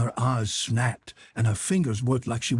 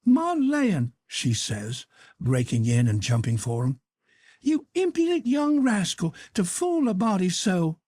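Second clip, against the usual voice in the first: higher, joyful exclamation; an aunt rushing to hug him; happy scolding of a rascal; delighted Aunt Sally; 7 semitones higher than usual. A narrator's reading.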